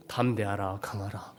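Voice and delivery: voice of God. Retold or imitated. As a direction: speak as a calm divine voice. Voicing God.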